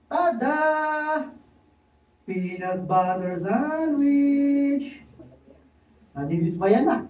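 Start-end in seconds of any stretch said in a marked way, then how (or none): 0.1-5.0 high, slow showman's reveal; proud reveal. Acted. Juan grandly reveals his sandwich to Pedro, high and slow. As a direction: unveil it grandly.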